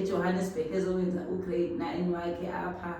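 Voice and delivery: ordinary delivery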